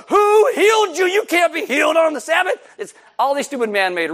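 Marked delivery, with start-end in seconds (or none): 0.1-2.5 high, loud indignant shout; hammed-up religious official outraged. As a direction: demand answers, appalled.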